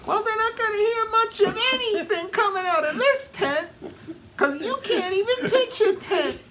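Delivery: very high mocking wife voice; shrill taunting falsetto. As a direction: squeal the insult like a cartoon wife.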